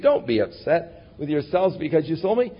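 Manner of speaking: usual speaking voice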